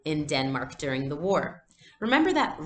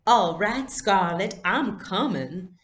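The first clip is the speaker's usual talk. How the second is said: higher, louder, drawn-out society lady; sophisticated voice; 4 semitones higher, 5 dB louder, slower than usual. Acted. Friend answering in the doll game, sophisticated and grand. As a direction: play the elegant Southern belle. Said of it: "Ellen replied in a sophisticated voice".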